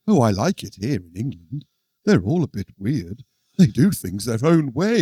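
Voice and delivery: normal talking voice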